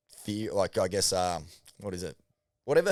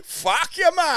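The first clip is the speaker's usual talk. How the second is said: mock filmmaker yell; 7 dB louder, slower than usual. Voicing the filmmaker telling off viewers.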